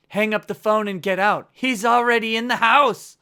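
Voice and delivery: building mock panic; mock urgent warning. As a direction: shout a hammed-up escape warning. Mock horror-movie phone warning.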